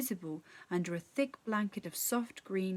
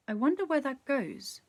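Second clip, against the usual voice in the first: curious musing; 6 semitones higher than usual. A narrator's reading. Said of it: "she thought to herself".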